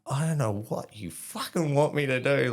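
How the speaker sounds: high-pitched retold exasperation; exasperated swearing back. Recruit swears back at yelling corporal, exasperated retold outburst. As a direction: swear back at your instructor, voice climbing. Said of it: "started swearing back at him".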